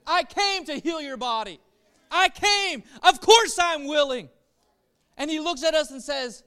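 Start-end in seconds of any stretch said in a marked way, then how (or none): 0.1-4.3 shouted "No," then higher, louder declarations; preacher voicing Jesus' emphatic promise; Jesus declaring. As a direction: cry it out to set them free. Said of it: "And Jesus says".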